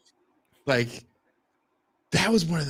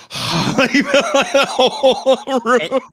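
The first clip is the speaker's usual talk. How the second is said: laughing; 13 semitones higher, 9 dB louder, much slower than usual. Retold or imitated. Everyone reacts after the guy leaves.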